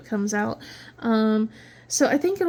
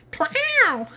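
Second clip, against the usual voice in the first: cat meow; 10 semitones higher than usual. Retold or imitated. Imitating the cat's meow.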